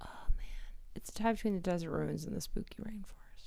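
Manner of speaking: hushed spooky whisper. Real, not acted. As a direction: breathe it out softly.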